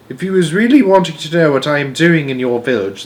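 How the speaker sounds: lightly voiced giant starting to confide; BFG. The giant agrees to tell Sophie, lightly voiced.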